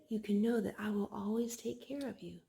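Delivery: whispered promise, no voice at all; soft whisper. Acted. Parent reading to child, whispering God's promise. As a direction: breathe it like a secret.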